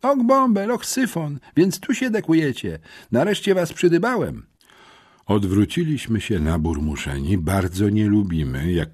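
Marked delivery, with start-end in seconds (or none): 0.0-4.5 put-on high, squeaky boy's voice; squeaky little voice. A narrator's reading. Boy surprising friends, in a squeaky voice. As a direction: pipe it up thin and high. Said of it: "piskliwy głosik".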